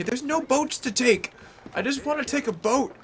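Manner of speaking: loud, high frustration; exasperated. Real, not acted. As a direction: complain loudly about the boats. Frustrated, can't find a boat.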